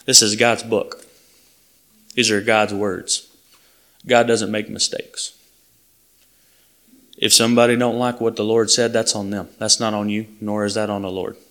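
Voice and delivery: ordinary talk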